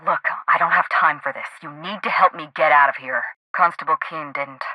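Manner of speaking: ordinary delivery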